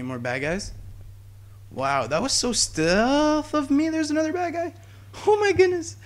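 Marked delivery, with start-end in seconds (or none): 1.7-6.0 high-pitched startled squeal; alarmed surprise. Real, not acted. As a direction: squeal in shock at being caught. Spotted by enemy mid-boast.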